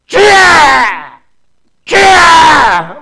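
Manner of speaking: slow, drawn-out shouted cries; crying out Lord. Retold or imitated. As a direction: cry out as if praying all night. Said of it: "밤새 이러고 있는 거요". (밤새 길게 외치는 "주야")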